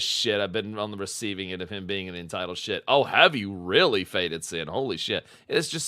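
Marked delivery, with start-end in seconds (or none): none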